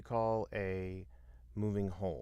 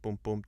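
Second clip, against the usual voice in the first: boom going down; 4 dB louder than usual. Retold or imitated.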